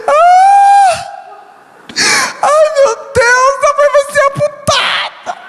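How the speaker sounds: high wailing sob, imitated; sister crying. Sister crying on the phone about amputation, wailing. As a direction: wail like the frightened sister. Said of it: "ela chorou".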